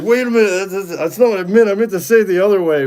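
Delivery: high-pitched, loud imitation of a flustered friend; flustered backpedalling. Friend correcting himself to mom, flustered high mock voice. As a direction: squeak out a hurried mock correction. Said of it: "And he's like".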